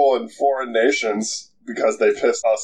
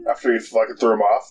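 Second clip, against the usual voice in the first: ordinary talk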